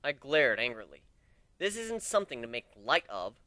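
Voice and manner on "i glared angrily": plain reading voice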